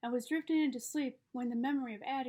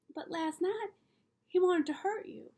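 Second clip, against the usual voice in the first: put-on higher, louder child's objection; protesting child; 7 semitones higher, 3 dB louder than usual. A narrator's reading. Child reminding father he was nearly hurt, high protest. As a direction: push back in a raised child's voice.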